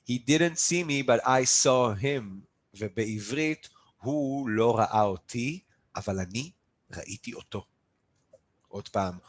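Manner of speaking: normal talking voice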